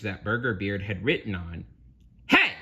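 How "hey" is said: short, high outraged "hey"; pirate shout. Acted. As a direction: shout to stop a thief. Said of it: "Burger Beard shouted".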